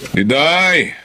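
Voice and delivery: father calling his daughter to come downstairs. Acted. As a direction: call her name to fetch her from another room.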